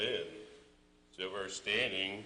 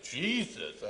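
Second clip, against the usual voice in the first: gasped prayer; 9 semitones higher than usual. Retold or imitated. Facing son's pointed gun.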